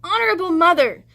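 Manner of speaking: put-on high, loud gasping little boy; breathless child getting the name wrong. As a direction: jumble the name while gasping. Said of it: "he gasped".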